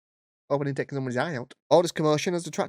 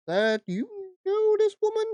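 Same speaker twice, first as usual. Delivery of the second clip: high staff voice. Retold or imitated.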